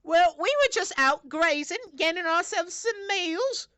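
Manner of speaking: high, slow squeaky rabbit indignation; defensive rabbit voice. Acted. Rabbit justifying the attack on the farmer. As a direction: plead the rabbits' case.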